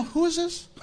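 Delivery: high, questioning bystander voice with a laugh; a hint of a baffled passerby; puzzled bystander. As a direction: play the confused onlooker, lightly. Preacher voices a confused bystander.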